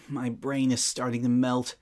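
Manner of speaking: ordinary talk, nothing marked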